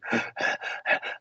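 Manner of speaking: short, wordless puffs of breath; laboured chesty breathing. Shown as a demonstration. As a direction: imitate someone struggling to breathe. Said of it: "a type of breathing on me".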